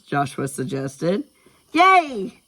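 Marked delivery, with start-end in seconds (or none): 1.7-2.4 put-on very high, loud child's cheer; high-pitched burst of glee. A narrator's reading. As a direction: cheer like a thrilled little girl. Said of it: "Skylar cheered".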